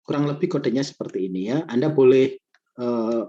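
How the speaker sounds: stuffy nose from a cold; talking through a head cold; sniffling. Real, not acted. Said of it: "ini saya pilek banget".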